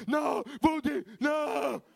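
high desperate scream. Retold or imitated. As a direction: shout for the dog in panic. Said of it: "comincio a urlare".

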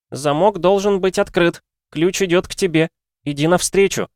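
высокий звонкий голос ребёнка; как таинственный ребёнок, высоко и звонко; звонкий детский голос. A narrator's reading. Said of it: "скорее даже детский голос произнёс". (звонкий детский голос свыше)

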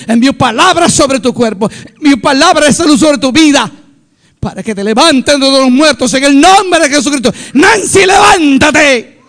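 prayer building to a high, loud shout; commanding healing prayer. Retold or imitated. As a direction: command her to rise, stretching the final word. Re-enacts commanding prayer for the dying.

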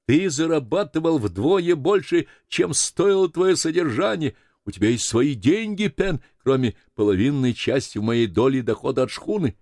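heated reassurance. Acted. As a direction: insist forcefully to convince a friend. Continues his heated protest to Pen. (горячо, повышенным голосом убеждая)

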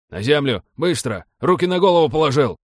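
armed raider's bark; shouted orders. A narrator's reading. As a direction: force him down with your voice. Armed men force captive down.

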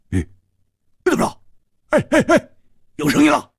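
very high, urgent exclamations; agitated high-pitched calling; eager excitement. Acted. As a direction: jump on the news in a high voice. Excited at a sound being heard.

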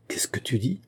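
hushed, stunned question rising at the end; soft disbelief, taken aback; startled. A narrator's reading. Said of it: "Georges eut un violent sursaut". (question stupéfaite, à voix basse)

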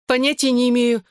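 high shriek. A narrator's reading. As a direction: squeak it out in panic. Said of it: "проверещала Клэр".

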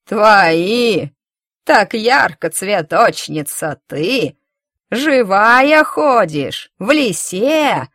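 loud, slow crone lashing out; old woman attacking. A narrator's reading. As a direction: pounce on her with venom. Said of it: "накинулась на неё старуха".

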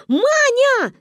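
высокий громкий зов; кричать подругу со двора; заорали. Acted. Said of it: "заорали мы". (громкий оклик)

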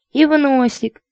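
high, squeally coquette; squealing lady. A narrator's reading. Lady to her suitor, squealing for kisses. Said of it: "Что ж так визжать-то?".

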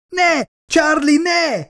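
very high, drawn-out cry; piercingly high, slow horrified plea; desperate scream. A narrator's reading. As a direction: scream to stop a loved one. Woman watches loved one facing death.

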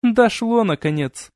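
high start dropping away, lightly smug; smirking. A narrator's reading. Vampire girl to the friend who finally understood, smirking. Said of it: "ухмыльнулась киса".

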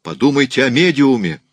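high, loud desperate appeal; shouting. A narrator's reading. Raoul pleads for the medium, desperate shout. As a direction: cry out to make her listen. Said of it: "кричал он".

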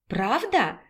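softly asked, falling question; mild surprise. A narrator's reading. Raina hears her sister fried her favourite chebureki, mild surprise. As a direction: ask it softly, in quiet disbelief.

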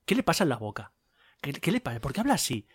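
softly puzzled mutter; half-voiced puzzled aside to himself; muttered inner complaint. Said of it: "digo yo". (murmurando desconcertado para sí)

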